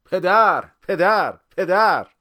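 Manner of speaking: high, very loud repeated cheer; full-voiced rhythmic calls; crowd shouting. A narrator's reading. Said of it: "یه مرتبه همه داد زدن".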